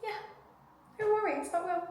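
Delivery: high, soft, mock-sweet yes; sarcastic sing-song reply; sarcastic agreement. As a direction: fake cheerful brush-off. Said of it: "And it's like".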